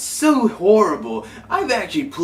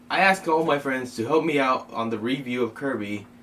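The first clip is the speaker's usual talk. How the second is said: usual speaking voice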